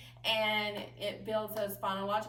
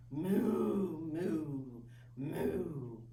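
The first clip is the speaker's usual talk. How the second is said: lower, fading moos; low cow moo; 7 semitones lower, 3 dB quieter, much slower than usual. Shown as a demonstration. Teacher showing children a cow's moo, low. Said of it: "your animal sounds".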